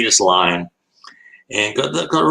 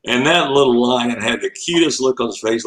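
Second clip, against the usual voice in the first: ordinary delivery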